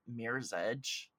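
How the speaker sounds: ordinary talk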